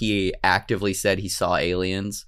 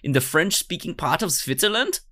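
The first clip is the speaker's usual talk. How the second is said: put-on high, hurt comic German accent; wounded German-accented whine; 7 semitones higher than usual. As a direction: protest plaintively in mock German English. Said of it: "that's why I have this German accent".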